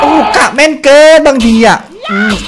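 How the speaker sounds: high, loud gasp of amazement; amazed exclamation. Real, not acted. Streamer marvelling at his own perfect shot, loud amazement.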